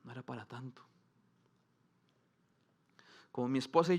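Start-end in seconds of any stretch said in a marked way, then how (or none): 0.0-0.8 disappointed mutter. Retold or imitated. A listener shrugging off a faded treasure, softly let down. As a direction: drop to a soft, let-down murmur. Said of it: "uno dice".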